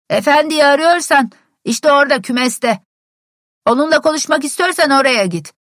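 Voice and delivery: loud, high shout across the yard; calling out. A narrator's reading. Servant yells directions from the stable window, loudly. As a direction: bellow directions to the visitor outside.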